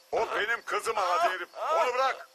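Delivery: high, strained shout of a desperate father; desperate angry shout. Acted. Father demanding his daughter's release, desperate angry shout. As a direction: yell it high and urgent.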